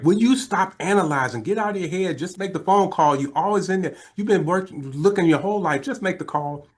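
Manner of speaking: high-pitched imitation of a nagging wife; exasperated wife. Wife urging husband to make the call, imitated exasperation. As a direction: scold him in a mock wifely voice. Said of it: "You gotta know my wife".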